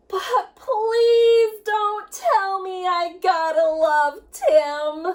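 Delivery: slow, drawn-out whiny plea, half sung; sing-song groan of protest; pleading whine. Acted. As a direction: drag it out like a kid begging. Child's pleading punchline.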